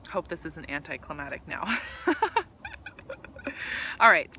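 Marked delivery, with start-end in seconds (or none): 2.0-4.4 emotional laughter. Real, not acted. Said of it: "why I'm emotional".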